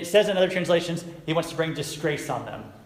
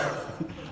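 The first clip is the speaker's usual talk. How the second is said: short, soft breathy laugh; amused chuckle; 11 dB quieter than usual. Real, not acted. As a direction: chuckle quietly at the memory. Amused by retelling a memory.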